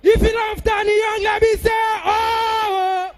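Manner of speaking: high-pitched crowd-rousing shout; hyping the crowd. Real, not acted. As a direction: work up a rally audience.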